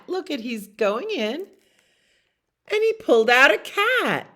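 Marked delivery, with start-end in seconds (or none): 2.7-4.3 much higher and louder, the big reveal; surprised reveal. A narrator's reading. Reading to children, revealing the cat with glee. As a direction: make the cat a delightful shock.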